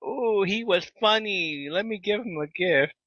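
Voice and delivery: a hint of someone pleased, slowly; imitating the gift-giver.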